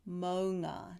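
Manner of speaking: "maunga" with the ng from the back of the tongue; ng sound. Shown as a demonstration. Teacher shows the ng in "maunga". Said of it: "the back of the tongue touches the roof of the mouth".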